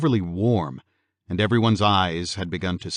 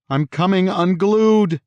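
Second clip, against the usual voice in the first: high, loud, drawn-out nightmare cry; yell; 12 semitones higher, 7 dB louder, slower than usual. A narrator's reading. Husband torn in two in his nightmare, yelling. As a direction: yell it out slowly, pitch soaring. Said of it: "George yelled".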